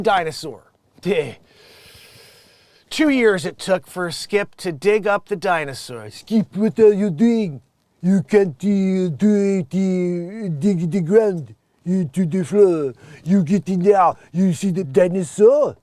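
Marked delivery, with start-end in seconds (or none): 6.1-15.8 put-on loud, slow, thick French accent; exaggerated French dog owner. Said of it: "and the French accent".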